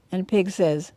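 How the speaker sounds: usual speaking voice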